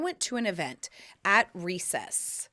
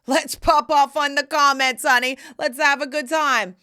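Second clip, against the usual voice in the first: loud, raised-pitch party call; hyped-up rallying; 5 semitones higher, 9 dB louder than usual. Real, not acted. Host rallying her viewers to comment, loudly hyped. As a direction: whoop the audience into joining in.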